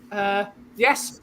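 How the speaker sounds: ordinary talk, nothing marked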